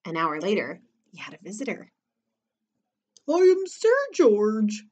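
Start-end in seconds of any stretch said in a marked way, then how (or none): 3.3-4.9 high, slow, squeaked announcement; thin-voiced knight introducing himself; squeaky knight. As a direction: squeak it out as a timid storybook knight. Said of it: "squeaked a thin, skinny knight".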